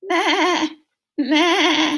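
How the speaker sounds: loud, high bleating; goat bleat. Retold or imitated. Reading to children, loud high goat bleat. Goat noise imitated loudly.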